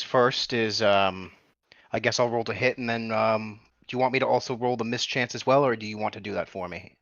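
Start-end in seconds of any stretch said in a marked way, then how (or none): none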